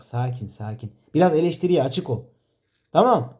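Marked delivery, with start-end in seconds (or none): 0.0-0.9 soothing hush. Real, not acted. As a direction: ease him down gently, almost under your breath. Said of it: "sakin ol".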